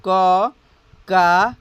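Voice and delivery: क, का each held and set apart; measured syllable recital of क, का; vowel-sign drill. Shown as a demonstration. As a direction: chant क, का slowly for learners. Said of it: "उसकी चढ़ाव उतराव क्या है".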